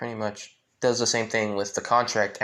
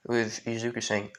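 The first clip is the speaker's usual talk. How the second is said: plain reading voice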